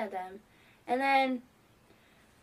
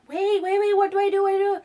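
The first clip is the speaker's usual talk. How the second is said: higher and louder frantic pleading; panicked squeal; 9 semitones higher, 9 dB louder than usual. Retold or imitated. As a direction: flap in starstruck panic. Panicking fan voice.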